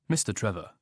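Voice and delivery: usual speaking voice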